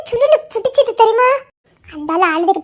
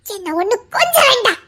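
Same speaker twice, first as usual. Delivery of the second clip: ordinary delivery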